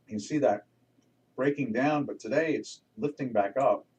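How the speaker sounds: talking while chewing gum. Real, not acted. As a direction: chew gum between the words. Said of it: "I put a piece of gum in my mouth".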